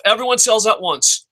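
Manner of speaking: usual speaking voice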